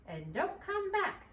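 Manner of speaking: high, loud, slow yell; shouting. A narrator's reading. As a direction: yell after him furiously. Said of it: "shouted Mister Squirrel".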